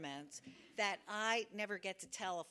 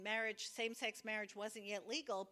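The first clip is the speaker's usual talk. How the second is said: ordinary delivery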